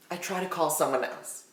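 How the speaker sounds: low-key, very quiet storytelling aside; hushed repeat. Real, not acted. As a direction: drop right down to a hush. Repeats the line hushed for suspense.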